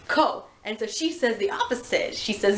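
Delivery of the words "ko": slyly higher single word, setting a trap; sly smile. Retold or imitated. Nagisa baits her into a trap with a sly smile. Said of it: "he just smiles, and he goes".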